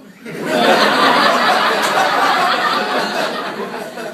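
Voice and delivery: big breathy laugh, loud; helpless loud laughing fit; loud burst of laughter. Real, not acted. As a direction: howl with laughter at the joke. Cracking up at own quote.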